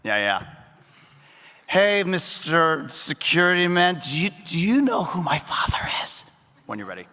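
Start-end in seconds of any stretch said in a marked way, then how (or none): 1.7-6.2 put-on drunken posh sneer. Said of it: "let me give you a little cue line into it".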